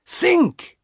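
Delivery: very high-pitched, urgent plea; desperate husband's cry; pleading cry. A narrator's reading. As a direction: beg her to see sense. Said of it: "her husband cried again".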